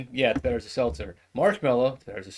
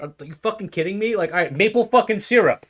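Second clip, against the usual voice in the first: higher, louder exasperated blurt; exasperated outburst; 8 semitones higher, 7 dB louder than usual. Acted. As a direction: throw up your hands and blurt it. Exasperated employee blurts maple syrup.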